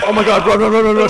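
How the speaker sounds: loud urgent yelling; panicked shout. Real, not acted. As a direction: yell at them to flee. Monster charges.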